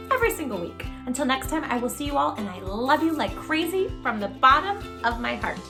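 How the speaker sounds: ordinary delivery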